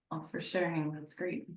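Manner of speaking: ordinary talk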